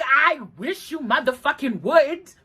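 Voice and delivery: hammed-up confrontational challenge; defiant dare. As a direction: dare them, drawing each word out. Daring him to hit her, then retracted.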